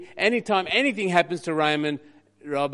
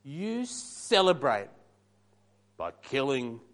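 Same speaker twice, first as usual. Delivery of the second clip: [name]'s sass; 4 semitones higher, 6 dB louder, slower than usual. Acted. Preacher voicing the bitter older son answering his father, sassy and slow. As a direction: lay on the grievance, drawing each phrase out then dropping away. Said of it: "I'm gonna try to give you the right sass".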